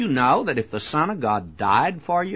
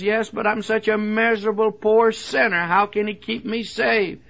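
mock doubter; 8 semitones higher than usual. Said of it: "somebody says".

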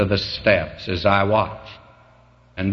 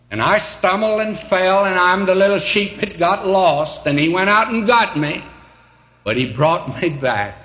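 rising preaching fervor; 9 semitones higher than usual. Acted. Preacher telling how the shepherd brought him back, building. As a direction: climb higher with every phrase.

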